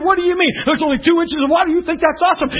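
exasperated, rising questions; incredulous challenge. Acted. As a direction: play a professor baffled by her excitement. Professor challenges the excited girl.

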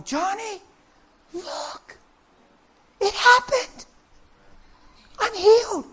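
put-on high, hushed child's wonder; small, high, breath-caught excitement; amazed little girl. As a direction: imitate a child marvelling to a brother. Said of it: "she said her little brother's name".

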